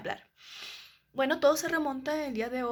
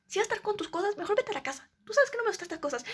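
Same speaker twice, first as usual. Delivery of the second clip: mimicking mom's angry scolding, pitch higher; retold angry mother; 6 semitones higher, faster than usual. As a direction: play the furious mom, building as it goes. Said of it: "me gritó y me dijo".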